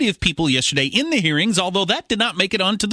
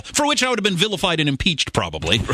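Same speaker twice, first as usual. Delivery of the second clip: ordinary talk, nothing marked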